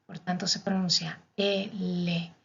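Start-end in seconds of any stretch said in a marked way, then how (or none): 1.4-2.3 "L" as el-le, split into two beats; double consonant. Shown as a demonstration. Teacher showing Italian L as two L's, deliberate demo. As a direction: hold both l's in "L". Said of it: "es como que tuviéramos dos L".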